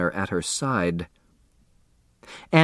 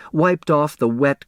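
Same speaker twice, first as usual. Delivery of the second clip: plain reading voice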